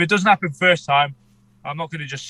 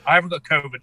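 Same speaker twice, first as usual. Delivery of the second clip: ordinary delivery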